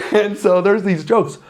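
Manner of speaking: high, loud words through real laughter; laughing. Real, not acted. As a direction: still cracking up as he talks. Laughing after his own joke.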